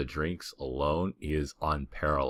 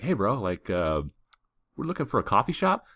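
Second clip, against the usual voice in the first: much higher, eager bro voice asking around; eager partygoers; 6 semitones higher than usual. Retold or imitated. Two dudes looking for a coffee shop, eager and casual. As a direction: ask like a keen tourist bro.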